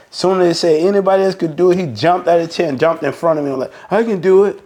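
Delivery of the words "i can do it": mimicking an eager volunteer, higher pitched; retold eager offer, pitched up. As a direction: pipe up as if volunteering, mockingly.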